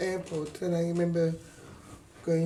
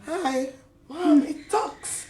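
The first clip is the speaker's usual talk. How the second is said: put-on high squeaky child voice, much higher; mock toddler voice; imitating a small child; 9 semitones higher, 7 dB louder, much slower than usual. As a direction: play a wide-eyed toddler piping up. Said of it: "and I went".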